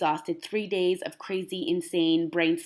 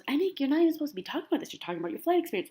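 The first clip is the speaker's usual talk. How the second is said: fast, higher mock scold; self-scolding voice; 8 semitones higher, much faster than usual. Mom scolding herself for going off topic, fast and high. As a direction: rush it as an inner voice telling you off. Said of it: "scolding herself as another voice".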